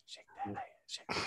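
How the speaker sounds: hushed, whispered catcall. Acted. Podcaster jokingly repeating a catcall under the talk, whispered. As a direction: whisper it almost without voice.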